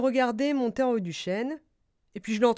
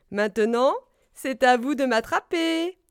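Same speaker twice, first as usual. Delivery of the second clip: loud, higher, playful dare; gleeful exclamation; 3 semitones higher, 6 dB louder than usual. A narrator's reading. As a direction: challenge friends to a game of tag. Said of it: "s'exclama gaiement Écureuil". (défi lancé gaiement, voix haute)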